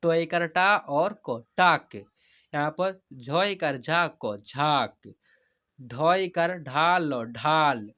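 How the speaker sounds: very slow teacher's demo, long stretched vowels; slow spelling-out. Shown as a demonstration. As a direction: spell each letter slowly, then draw out the whole word. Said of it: "जैसे मैं हिजे करा कर पढ़ रहा हूँ". (धीरे-धीरे हिज्जे करके, स्वर खींचकर)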